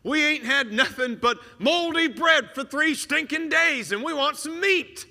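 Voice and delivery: high, loud whining grumble; whiny complaining. Retold or imitated. Israelites grumbling to God in the wilderness, a hammed-up whine. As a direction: whine like a hungry mob. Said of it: "the Israelites in the wilderness going".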